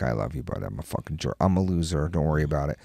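low, hushed, sheepish apology; apologetic dad. Retold or imitated. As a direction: softly own up to your kid. Dad apologising to son afterwards.

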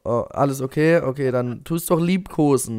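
ordinary talk, nothing marked

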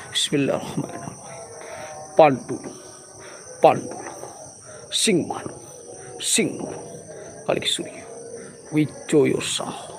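breathless whispered spell; hushed unvoiced prayer words; whispered incantation. Real, not acted. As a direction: murmur the charm under your breath. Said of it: "whispered incantation".

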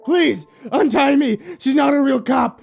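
high, frantic captive's plea; desperate shouting. A narrator's reading. Spencer pleads with Donald to untie him, desperate shouting. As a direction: shout accusations, begging to be saved.

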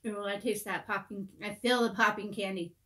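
talking while biting cookie; chewing between words; mouth full of cookie. Real, not acted. Seen 0: Speaker holds red cookie at lips, biting it throughout the words.

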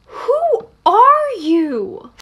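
high, drawn-out astonished question; dramatic demand. Acted. Classmate confronts a mystery figure, dramatic drawn-out demand. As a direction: stretch each word out high.

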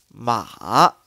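slow 马 dipping low then rising; third tone. Shown as a demonstration. Teacher demonstrating Mandarin third tone slowly. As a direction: draw 马 down to the floor and lift it. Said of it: "就四这个调".

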